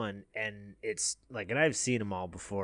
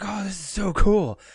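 put-on excited kid, higher and louder; freaking out; 10 semitones higher, 8 dB louder than usual. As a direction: imitate a son geeking out. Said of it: "he's freaking out".

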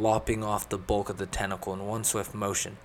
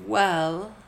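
high, hesitant little opener; pitched up and unsure; a bit sheepish; 10 semitones higher, 6 dB louder than usual. A narrator's reading. Elizabeth about to suggest her magic, sheepish high voice. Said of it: "a bit sheepishly".

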